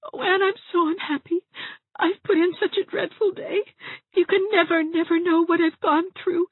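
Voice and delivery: put-on very high, whimpering girl's voice; tearful Dovey, miserable. A narrator's reading. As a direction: wail self-pityingly in a high tearful voice.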